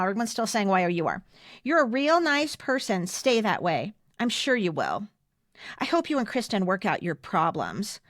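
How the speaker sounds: normal talking voice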